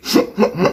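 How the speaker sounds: quick run of voiceless hiccup noises; involuntary little stuttered hiccups; hiccup tic. Real, not acted. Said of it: "questions about my hiccups".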